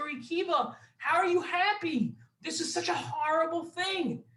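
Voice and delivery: high, pleading disbelief; mild upset friends asking Rabbi Akiva. Acted.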